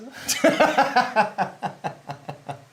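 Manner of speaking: big hearty burst of laughter; cracking up loudly. Real, not acted.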